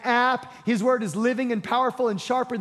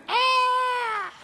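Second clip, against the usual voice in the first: high mock scream of the crowd. Preacher imitating the crowd's terrified scream. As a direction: yelp it like the frightened crowd. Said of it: "People are screaming".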